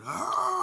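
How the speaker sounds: high, loud drawn-out scream; imitated scream. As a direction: wail like the terrified old man. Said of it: "the way he was screaming".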